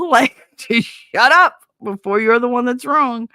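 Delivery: laughing self-scold. Real, not acted.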